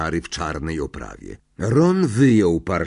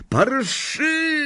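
high-pitched, urgent call; cry out. A narrator's reading. Owner calling his bolting rat, high urgent cry. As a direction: call out after a runaway pet. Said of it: "krzyknął Ron".

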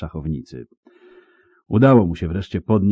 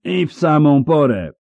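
high, raised call; loud, high cry of relief; shouting; 9 semitones higher, 5 dB louder than usual. A narrator's reading. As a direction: shout it while approaching. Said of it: "krzyknął nadchodzący".

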